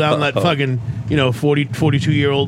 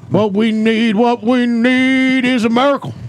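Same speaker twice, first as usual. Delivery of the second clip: raised, fiery televangelist; preacher voice; 6 semitones higher than usual. Acted. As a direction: preach it to the congregation. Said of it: "this new character called Pastor Pat".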